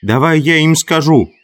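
high, insistent plea to be heard; urgent call. A narrator's reading. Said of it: "пробился голос Хрюши".

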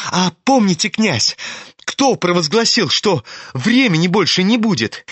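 high-pitched, excited proclamation; feverish Ippolit. Acted. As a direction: declaim excitedly, pitched well above your own. (лихорадочно, высоким голосом)

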